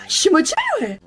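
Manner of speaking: high, exaggerated squeal of enthusiasm; hyped-up motivation. Student psyching herself up to revise, hammed-up excitement. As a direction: cheer yourself on in a high voice.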